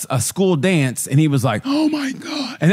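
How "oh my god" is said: shocked gasp. Retold or imitated. Iranian guy shocked at dance.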